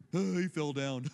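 low, drawn-out guffawing words; mock slapstick laughter. Viewer watching a pratfall on home videos, mock guffaw. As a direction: laugh through it like a tickled viewer.

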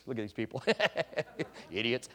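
mocking aside. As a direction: sneer at the celebrating crowd under your breath. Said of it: "he'd go".

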